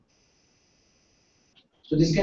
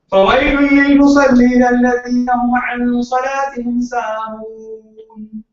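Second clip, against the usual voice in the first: Quranic recitation; 6 semitones higher, 8 dB louder than usual. Acted. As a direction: intone it like a recitation in the mosque.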